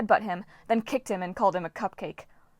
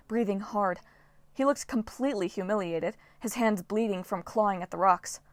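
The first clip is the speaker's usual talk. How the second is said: ordinary delivery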